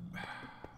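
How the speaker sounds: long breathy unvoiced sigh; tired sigh before laying down the law; weary sigh. Real, not acted. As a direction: sigh out in resignation. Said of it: "put my head down".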